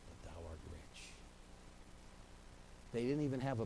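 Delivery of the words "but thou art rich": much softer and lower; Jesus comforting the poor church, softly; hushed tender verse. Acted. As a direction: lean in and say it gently.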